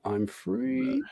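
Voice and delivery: high sing-song "I'm free", lightly voiced; catchphrase impression.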